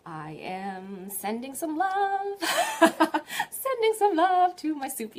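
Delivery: slow, sing-song inner voice breaking into a laugh; loving cook. Retold or imitated. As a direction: croon love into the soup. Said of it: "I want you to be thinking".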